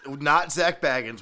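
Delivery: loud, slow correction; emphatic correction. Real, not acted. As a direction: drag it out for the joke.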